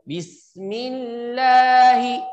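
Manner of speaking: Bismillah with the lam held very long; wrong drawn lam. Shown as a demonstration. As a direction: stretch the l in Bismillah too long. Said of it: "Jangan digunakan".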